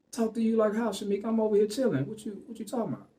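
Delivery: high, baffled protest, quick at the end; retold indignant confusion. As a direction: act innocent and puzzled. Protesting innocence to angry host.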